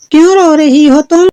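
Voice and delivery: high-pitched fairy voice; questioning fairy. Acted. Fairy finds a crying girl, high questioning voice. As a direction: ask gently high, as a fairy. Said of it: "एक परी आई".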